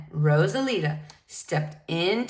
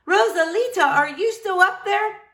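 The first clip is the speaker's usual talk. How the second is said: higher, louder call upward; 10 semitones higher, 4 dB louder than usual. Acted. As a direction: call up to the roof. Crowd calling up to roof.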